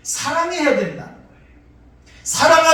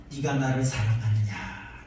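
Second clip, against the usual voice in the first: gentle question; 13 semitones lower, 7 dB quieter than usual. Acted. Jesus asks Peter gently.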